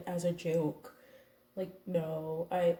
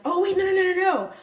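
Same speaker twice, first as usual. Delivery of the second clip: sudden realization; 10 semitones higher, 9 dB louder, faster than usual. Real, not acted. Sudden correction of own story.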